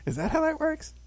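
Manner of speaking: put-on high, incredulous little-kid squeak; mock surprised child. Child self asking mother, high mock disbelief. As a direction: play your younger self hearing the nonsense. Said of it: "And I go".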